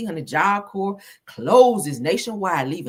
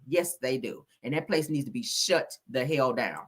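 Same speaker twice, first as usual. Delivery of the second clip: normal talking voice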